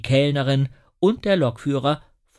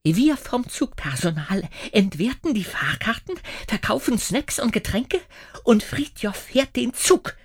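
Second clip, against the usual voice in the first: hissing indignantly; 9 semitones higher than usual. A narrator's reading. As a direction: spit out each duty with offense. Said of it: "faucht die Schaffnerin empört". (Schaffnerin faucht empört, höher)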